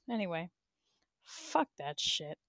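normal talking voice

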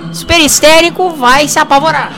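ordinary talk, nothing marked

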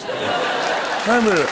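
very slow, mushy "Hamburger" as if chewing; mouth full. Shown as a demonstration. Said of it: "if your mouth was full of hamburgers".

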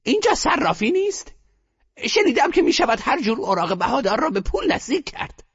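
put-on high, firm old woman's question; grandmother, firm and commanding; decisive. A narrator's reading. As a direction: ask as if already decided. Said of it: "با لحنی قاطع پرسید".

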